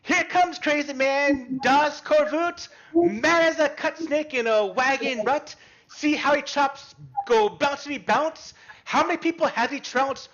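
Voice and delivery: put-on high, loud singsong kids' chant; taunting kids. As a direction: jeer it like a pack of children. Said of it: "they're just screaming this little, like, taunt".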